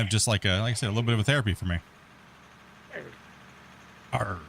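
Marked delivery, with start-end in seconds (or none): 4.1-4.4 pirate voice. Pretend. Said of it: "says the pirate".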